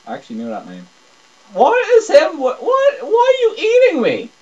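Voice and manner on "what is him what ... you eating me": much higher, loud bewildered cries; shocked disbelief at the screen; startled yelling. Real, not acted. Gamer yelling at the panda biting him, startled.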